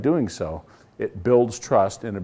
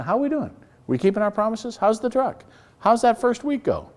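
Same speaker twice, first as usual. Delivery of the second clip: higher, bright sample check-in questions; blunt questions; 10 semitones higher than usual. Shown as a demonstration. Coach showing the owner how to call drivers, higher. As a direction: ask them straight out, lifted high. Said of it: "ask the blunt question".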